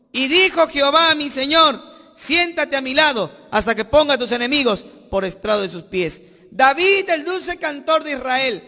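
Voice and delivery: loud, fervent sermon build; full-force preaching. Acted. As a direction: preach it out to the whole church.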